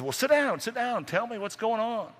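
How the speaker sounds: high grandma voice, fussing; old grandma voice. Acted. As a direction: fuss over him like a grandma. Grandma's voice, much higher.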